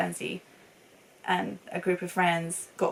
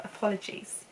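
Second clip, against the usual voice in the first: soft, voiceless aside; whispered apology; 8 dB quieter than usual. Real, not acted. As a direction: whisper it like a small sorry. Hushed repeat of the apology.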